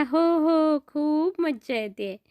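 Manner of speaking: put-on beam with joy as a small boy; delighted child. Boy telling the flying horse he is having fun, delighted.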